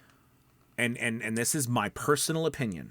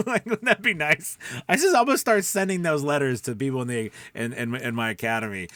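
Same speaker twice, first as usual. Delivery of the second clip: excited; 2 semitones higher, 5 dB louder, faster than usual. Real, not acted. Excited about sending letters.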